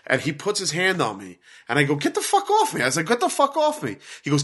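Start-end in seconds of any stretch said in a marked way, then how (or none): 1.9-2.8 retold angry protest. Retold scuffle with undercover cop. 3.1-3.9 much higher, indignant, not shouted; retold angry protest. Repeats his protest to the cop.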